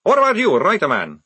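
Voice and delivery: loud, high snarling challenge; snarled. A narrator's reading. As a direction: round on him with a snarl. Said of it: "snarled Maledict".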